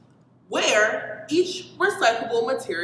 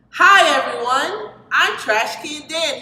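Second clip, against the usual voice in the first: cheerful trash can; 5 semitones higher, 7 dB louder than usual. A narrator's reading. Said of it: "he said".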